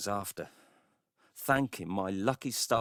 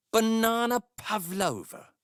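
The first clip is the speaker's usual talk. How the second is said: much higher and slower, scoffing at it; incredulous scorn; 12 semitones higher than usual. Real, not acted. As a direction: repeat it in disbelief, as if it's absurd. Scoffing at the neighbours' pettiness.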